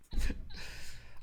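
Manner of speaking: breathy, voiceless chuckle; short amused laugh; breathy burst of laughter. Real, not acted. Amused by concern about time.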